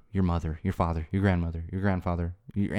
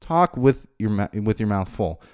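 ordinary talk

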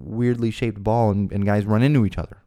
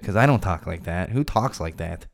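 ordinary talk, nothing marked

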